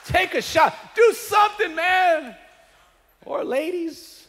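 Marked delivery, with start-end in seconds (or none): 0.0-2.3 louder, higher urging; shouted urging. Real, not acted. Preacher urging congregation to shout take a shot. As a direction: urge them to shout it. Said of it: "tell your neighbor, say".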